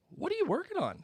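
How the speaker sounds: put-on high, light boyish question; lightly voiced boy; curious Harry. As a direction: ask it like an innocent younger boy.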